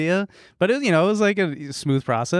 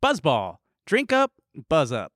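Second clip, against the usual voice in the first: ad read; 7 semitones higher than usual. Acted. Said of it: "our first free advertisement".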